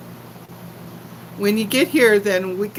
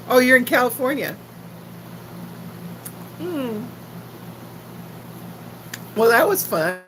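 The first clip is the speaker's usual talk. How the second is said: usual speaking voice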